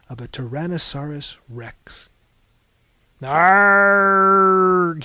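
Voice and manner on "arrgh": loud, high, sustained "arrgh"; full-voiced storybook monster roar; mock dinosaur roar. A narrator's reading. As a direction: roar it out long and loud like a Tyrannosaurus. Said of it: "he roared".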